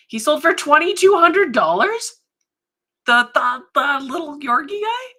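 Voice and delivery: high, loud disbelieving questions; astonished. Real, not acted. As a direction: blurt it out in real shock at the price. Astonished at a sale price.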